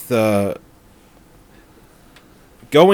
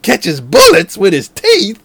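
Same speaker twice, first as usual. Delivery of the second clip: mock movie line; 7 semitones higher, 5 dB louder, slower than usual. Repeating the film's line.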